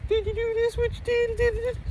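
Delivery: very high squeaky imitation of her pestering; high mocking girlfriend voice. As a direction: pitch way up as the nagging girlfriend. Mocking girlfriend's nagging questions.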